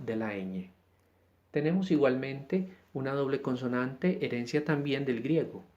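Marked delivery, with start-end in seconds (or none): none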